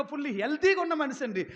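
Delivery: normal talking voice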